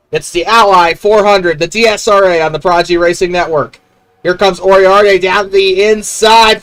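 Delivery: excited race play-by-play; motorsport commentator. Acted. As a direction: call the race start with energy. Live race call.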